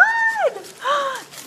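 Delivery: high-pitched gasp of delight; surprised gasp. Real, not acted. Host sees the giveaway basket, surprised gasp.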